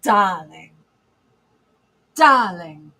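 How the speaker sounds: ordinary talk, nothing marked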